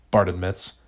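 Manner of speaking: usual speaking voice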